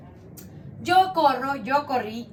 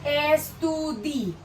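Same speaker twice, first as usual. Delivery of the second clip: "Estudi" drawn out very slowly; slow teacher's model of the stem "Estudi"; slow syllables; 3 semitones higher, much slower than usual. Shown as a demonstration.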